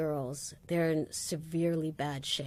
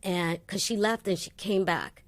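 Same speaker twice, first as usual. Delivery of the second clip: usual speaking voice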